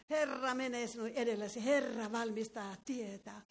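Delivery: normal talking voice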